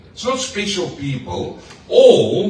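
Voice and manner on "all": "all" hit loud and high; stress on all. Shown as a demonstration. Preacher stressing "all" to the whole church. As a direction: drive home the word "all". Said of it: "that means everybody".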